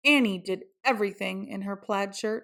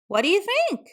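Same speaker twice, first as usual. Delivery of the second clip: eager Annie; 11 semitones higher, 4 dB louder, faster than usual. A narrator's reading. Annie shows off outfit.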